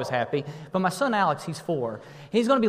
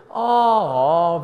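loud drawn-out aww along with the crowd; touched aww; 8 dB louder than usual. Shown as a demonstration. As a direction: lead the group aww. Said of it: "let's try that on the count of three".

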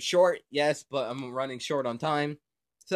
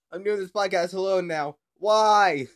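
louder, higher cry of mock despair; loud dismay; 5 semitones higher, 6 dB louder than usual. Real, not acted. Left alone after brother hung up, louder and higher. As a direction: wail at being left alone.

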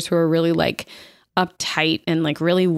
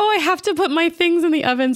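frantic woman; 10 semitones higher than usual. Retold or imitated. Sarah Jessica Parker freaks out.

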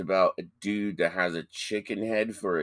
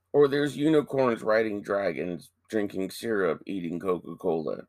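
ordinary talk

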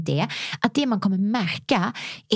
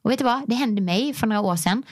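ordinary talk, nothing marked

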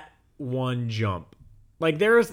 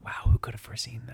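sarcastic whisper; 4 semitones lower, 3 dB quieter than usual. Real, not acted.

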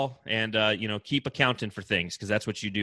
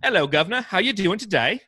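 a hint of a British greeting; mock posh British accent; 7 semitones higher, 3 dB louder than usual. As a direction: tip your hat in a light British accent. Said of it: "Oh, that wasn't an accent".

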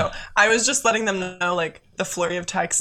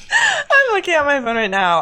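laughing; 12 semitones higher, 5 dB louder than usual. Real, not acted. Recalling friend's flood of messages.

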